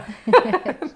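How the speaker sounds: high, loud burst of laughter. Real, not acted. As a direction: crack up loudly. Laughing after the joke cry.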